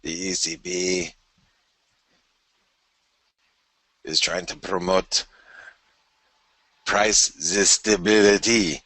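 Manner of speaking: put-on slow, drawn-out French-accented central banker; hammed-up French accent quoting a bank chief; mock French accent. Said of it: "that was a horrible accent".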